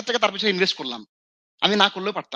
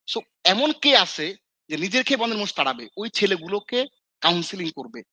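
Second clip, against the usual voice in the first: usual speaking voice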